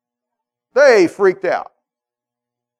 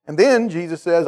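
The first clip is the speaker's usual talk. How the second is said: ordinary talk, nothing marked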